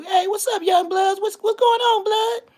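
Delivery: very high falsetto granny voice; neighborhood granny greeting. Retold or imitated. Granny greeting the young boys outside, high mock voice. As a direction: call out a high, loud hello like somebody's granny. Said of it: "she used to come out".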